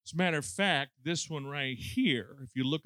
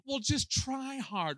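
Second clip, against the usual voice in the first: higher, slow, mocking take on an unhelpful adviser; mock preacher; 10 semitones higher, slower than usual. Someone standing up telling you to try, slow mocking advice. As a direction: parody a glib adviser. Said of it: "have somebody stand up and say".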